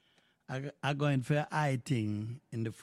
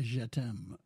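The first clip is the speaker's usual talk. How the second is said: ordinary talk, nothing marked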